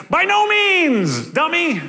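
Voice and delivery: high, slow, loud exasperated cry; Paul throwing up his hands at a silly question. Retold or imitated. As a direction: scold them like Paul, fed up. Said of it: "he does the voice in the Bible".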